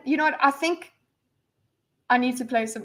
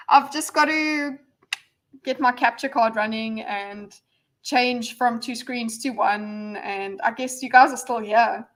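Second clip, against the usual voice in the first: ordinary talk, nothing marked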